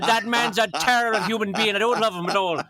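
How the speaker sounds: hammed-up grudge against a dead man; mock angry grandparent. Irish grandparent griping about a neighbor, mock angry. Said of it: "they're like".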